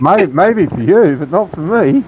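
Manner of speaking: chuckling through the words; laughing. Real, not acted. Laughing reply about holidays.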